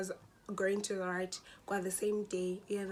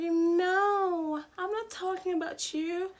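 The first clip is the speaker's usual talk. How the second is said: high, loud mock protest; annoying girl; 10 semitones higher, 7 dB louder, slower than usual. Classmate denying she meant the storyteller, mocking high voice. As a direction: mimic her in a high, exaggerated voice. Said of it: "she was so annoying".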